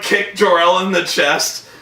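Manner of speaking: ordinary delivery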